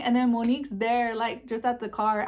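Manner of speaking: normal talking voice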